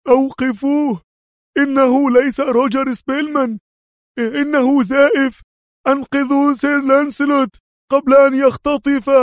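very high, urgent cry; shouting. A narrator's reading. Servant exposing the impostor, shouting. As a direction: scream the warning in a frantic high voice. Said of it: "وهو يعدو نحو سيارة أدهم صارخا".

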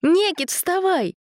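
high slow wake-up call; drawn-out shout to get up. A narrator's reading. Waking brother up.

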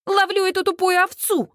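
high, exasperated holler; frantic high-pitched retort; yelling back. A narrator's reading. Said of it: "ору я в ответ".

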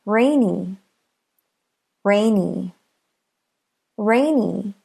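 "rainy" with RAI stressed; stress on first syllable. Shown as a demonstration. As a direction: say "rainy" with the beat on RAI, ny lighter. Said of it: "Listen to the first syllable".

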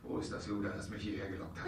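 gezischte Frage. Acted. Marquis sucht die Lockvogel-Frau.

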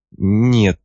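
usual speaking voice

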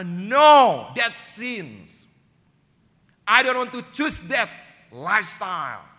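high, loud firm no; firm refusal. Retold or imitated. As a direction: refuse loudly and firmly. (penolakan tegas, tinggi dan keras)

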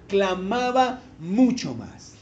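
"clamaba mucho más" said slowly, each word weighted; slow down and press on "mucho"; underlined phrase. Shown as a demonstration. Preacher underlining the verse for the congregation, slow and weighted. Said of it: "subraya esa frase ahí".

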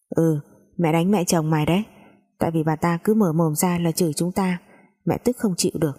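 ordinary delivery

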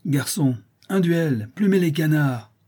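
high mocking jeer; sneering cry. A narrator's reading. Tortillard jeering at the schoolmaster, sneering cry. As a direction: sneer it out, a nasty little boy. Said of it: "il s'écria en ricanant".

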